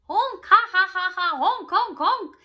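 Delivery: very loud high honking laughter; honking goose laugh. A narrator's reading. Goose laughing at Missus Mooley, loud honking laugh. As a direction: honk and cackle at full volume. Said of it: "The goose laughed so hard".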